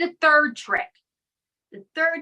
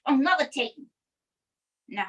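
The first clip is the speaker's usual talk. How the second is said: usual speaking voice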